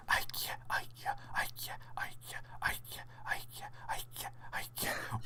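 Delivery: whispered, creepy looping message; hushed, unvoiced stalker mutter. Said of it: "really creepily, like I'm a stalker leaving a message".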